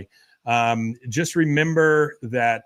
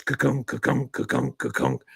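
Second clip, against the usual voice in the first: a mechanical ka-kunk, fading to breathless clicks; machine clunk noise; 3 dB quieter than usual. Retold or imitated. Host imitating the slaughterhouse kill bolt, mechanical clunks. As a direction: mimic a heavy bolt firing over and over.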